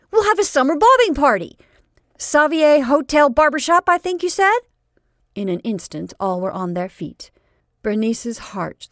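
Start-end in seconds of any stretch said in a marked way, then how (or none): 0.1-4.6 high, loud gleeful shouting; excited. Acted. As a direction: shout it with delight. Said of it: "he cried".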